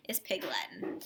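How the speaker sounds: ordinary delivery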